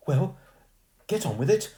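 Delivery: put-on posh girl losing patience; impatient girl. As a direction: hurry him up. Irritated girl urging ghost.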